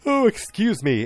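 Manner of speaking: high-pitched put-on voice; mock interjection. Acted quote in retold dialogue.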